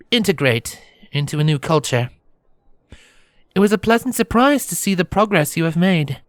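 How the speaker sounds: high-pitched princess, measured and sympathetic; formal princess. Acted. Princess offers sympathy and news.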